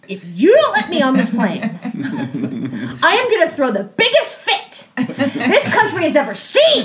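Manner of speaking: mock tantrum threat with big emphasis; retold hysterical threat. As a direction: start deliberately, then blow up. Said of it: "I was in hysterics at that point".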